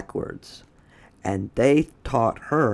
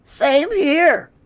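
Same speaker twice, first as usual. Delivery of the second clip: very high, louder character voice; Wilson's voice; 6 dB louder than usual. Acted. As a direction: squeak it out high and bright. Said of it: "said Wilson".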